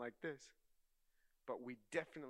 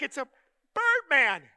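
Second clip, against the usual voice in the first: put-on scared kid; 13 semitones higher, 24 dB louder than usual. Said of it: "I'm like".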